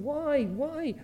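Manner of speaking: high, pleading cry of why; a grieving father crying out to heaven; anguished father. Retold or imitated. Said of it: "The man must have been saying".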